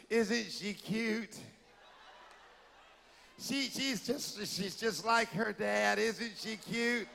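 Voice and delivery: slow, sugary mother covering embarrassment; forced sweet brag. Retold or imitated. A mother to onlookers, slow sugary forced cheer. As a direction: smile through it and gush slowly. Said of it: "and then you say".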